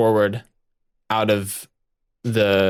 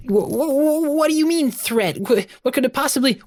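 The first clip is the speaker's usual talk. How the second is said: very high, stammering alarm; alarmed Webby. Acted. Webby questioning Viola about the threat, alarmed. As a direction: squeak out a startled question in a tiny high voice.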